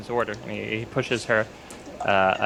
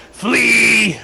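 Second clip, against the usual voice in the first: high, loud, drawn-out scream; screamed warning; 11 semitones higher, 11 dB louder than usual. Acted. Cedric warning his companions to run, screamed warning. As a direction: scream it to send them running. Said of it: "he just screams".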